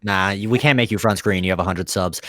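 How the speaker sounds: normal talking voice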